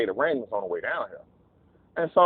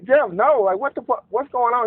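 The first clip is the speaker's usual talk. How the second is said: raised-voice, high retold objection; alarmed protest; 10 semitones higher, 3 dB louder than usual. Teller objecting to Jeff's sudden plan, alarmed protest. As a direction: protest in mock disbelief, pitched well up.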